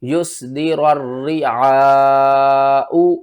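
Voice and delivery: یس دی رر ری آ او slow, pause held before او; teacher demonstrating saktah. Shown as a demonstration. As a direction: stop on آ without breathing, then او. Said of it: "سکتہ کر کے سمجھاتا ہوں".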